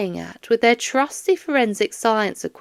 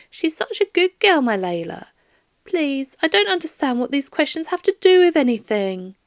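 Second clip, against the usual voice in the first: higher, fond grandmother voice; doting old lady; 7 semitones higher than usual. Acted. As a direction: gush about her granddaughter. Doris pleads confused.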